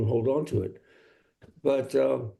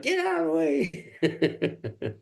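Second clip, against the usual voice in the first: imitated scream, high and drawn-out, laughing; mock yell pitched way up, slow and stretched; cri imité; much slower than usual. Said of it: "scream at you".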